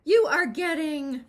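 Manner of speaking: loud, high, straining gasp. Acted. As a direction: heave the words out. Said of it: "gasped Granny".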